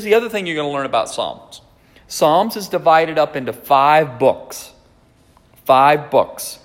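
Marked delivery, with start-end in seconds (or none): none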